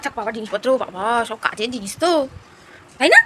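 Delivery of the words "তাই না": high, loud sweet coaxing. Real, not acted. Coaxing the child.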